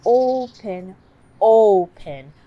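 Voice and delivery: "open" with lips pushed round; rounded exaggerated open. Shown as a demonstration. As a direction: model "open" big and slow for a child. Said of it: "let's try".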